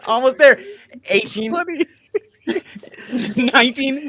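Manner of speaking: loud, high, stretched-out cheer; excited cheering. Real, not acted. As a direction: egg him on, laughing. Cheering friend on through push-ups.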